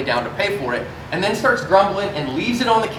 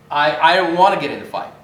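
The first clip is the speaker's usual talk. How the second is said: usual speaking voice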